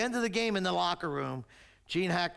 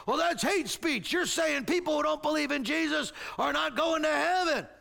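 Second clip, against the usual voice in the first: higher-pitched, affronted protest; indignant objector; 7 semitones higher than usual. Acted. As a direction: object in mock outrage. Acted objector protests the scripture.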